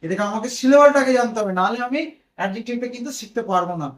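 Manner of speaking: ordinary talk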